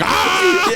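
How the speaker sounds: high-pitched comic scream through laughter; mock baby scream. Podcaster imitates the yelling baby, mock scream. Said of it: "The baby's like".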